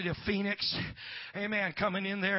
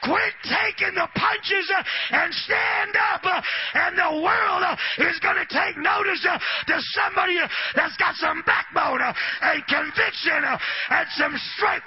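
high, shouted revival sermon; full-force preaching; 11 semitones higher, 12 dB louder than usual. Real, not acted. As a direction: drive every phrase home at the top of your voice, with gasped "uh" endings.

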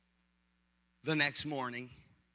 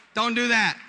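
loud, higher wife's protest; retold outburst; 4 semitones higher, 8 dB louder than usual. Wife to husband mid-fight, loud mock protest. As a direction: cut him off sharply with a loud order.

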